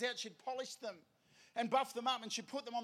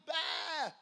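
sheep bleat; 5 semitones higher than usual. Acted. Lost sheep answers the shepherd, bleating. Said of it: "And it's like".

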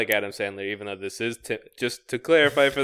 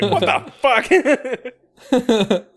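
shocked yell; 11 semitones higher, 7 dB louder, slower than usual. Retold or imitated. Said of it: "then she's like".

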